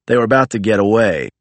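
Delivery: ordinary delivery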